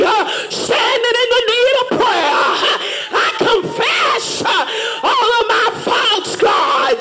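shouted, rhythmic sermon with whoops; preaching at full force. Acted.

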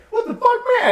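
very high squealing startled what the fuck; Chris Tucker impression. Chris Tucker spotting a giant, very high squeal. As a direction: squeak it out in shock. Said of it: "he goes like".

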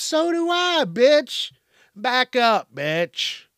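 high-pitched, exasperated comeback; indignant retort. Acted. Answering someone's health excuse, high indignant retort. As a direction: fire back at the excuse, voice jumping up high. Acted retort to mask refuser.

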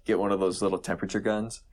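usual speaking voice

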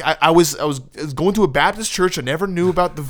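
normal talking voice

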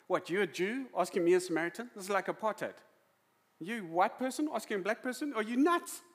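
loud, high, incredulous challenge; sarky retort. Acted. Samaritan woman challenging Jesus for asking, sarky. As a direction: throw it back as a cheeky, sarcastic question. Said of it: "now she's Saki".

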